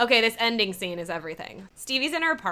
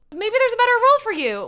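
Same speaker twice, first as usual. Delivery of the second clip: high, louder placating voice; 13 semitones higher, 5 dB louder, faster than usual. Retold or imitated. Jocelyn steers Alexis to another role, placating. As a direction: sugar the let-down, pitched high and quick.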